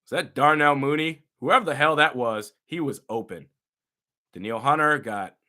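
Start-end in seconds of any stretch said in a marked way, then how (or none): none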